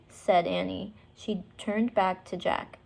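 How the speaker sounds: ordinary delivery, nothing marked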